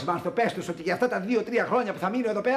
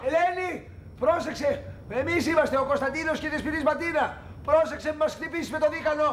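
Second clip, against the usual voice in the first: high, urgent warning; alarmed pleading; 7 semitones higher than usual. Acted. Man identifying himself to armed neighbour, alarmed pleading. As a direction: plead in a raised pitch not to be shot. Warns woman threatening with shotgun.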